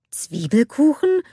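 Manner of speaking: slow, high, rising echo; puzzled question. A narrator's reading. Anders, confused by Anna's onion cake, asks puzzled. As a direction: ask it slowly, climbing in disbelief.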